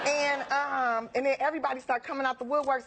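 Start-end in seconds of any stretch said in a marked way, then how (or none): none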